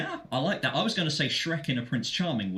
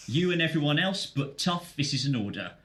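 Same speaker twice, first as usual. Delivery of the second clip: ordinary delivery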